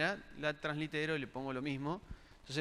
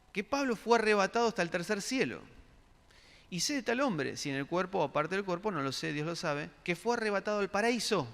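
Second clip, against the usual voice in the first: raised, louder Bible reading to the congregation; scripture reading; 3 semitones higher, 4 dB louder than usual. Acted. Preacher reading Paul's letter to the congregation, raised and loud. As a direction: proclaim the verses higher and louder. Reads Bible passage aloud.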